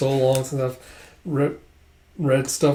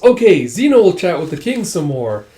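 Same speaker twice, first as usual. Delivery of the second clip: higher, louder and eager; perky announcement; 5 semitones higher, 7 dB louder than usual. Acted. Dragon grabs the king's arm, loud perky announcement. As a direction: announce it brightly to all.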